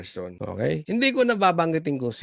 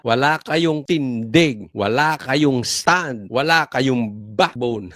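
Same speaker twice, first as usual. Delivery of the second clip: each phrase dragged out slowly, weighted; slow scolding; 5 semitones lower, much slower than usual. Real, not acted. Host berating the commission, slow and heavy. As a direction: spell out every word of the rebuke, slowly.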